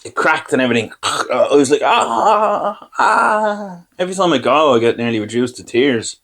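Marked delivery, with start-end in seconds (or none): 1.8-3.8 high, drawn-out mock cry of pain; open-mouthed pained wail. Patient having a root pulled, high mock wail. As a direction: wail "ah" high, as if mid-extraction.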